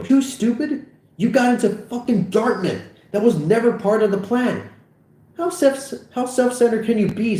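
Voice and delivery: high, heated rant; exasperated, high-pitched tirade; indignant retort. Acted. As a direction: fire back, pitch way up. Acted quarrel, friend retorts.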